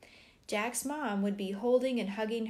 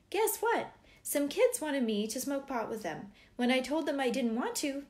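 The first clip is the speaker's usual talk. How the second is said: put-on higher, eager kid telling parents news; twelve-year-old boy; 6 semitones higher than usual. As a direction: voice a boy sharing news at dinner. Voices twelve-year-old Jack at dinner.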